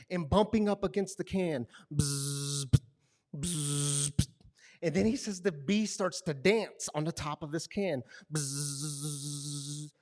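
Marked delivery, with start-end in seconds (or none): 1.9-4.3 short repeated bee buzzes; bumping bee buzz. Retold or imitated. Bee bumping against can. 8.3-9.9 long, soft buzzing bee; drawn-out bzzz of a bee. Retold or imitated. Bee dancing on can.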